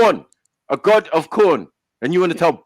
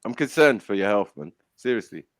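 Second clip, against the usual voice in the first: quiet, slowed-down earnest worry; sincere concern; 12 semitones lower, 9 dB quieter than usual. Real, not acted. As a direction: drop the banter and mean it. Sincere concern after teasing.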